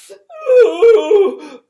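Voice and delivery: put-on loud high baby bellow "Ooh"; bellowing howl. Baby Morgan bellowing at the crowd, loud and high. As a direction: bellow like a wailing toddler. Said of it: "Morgan bellowed".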